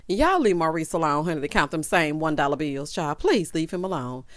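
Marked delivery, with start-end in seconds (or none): none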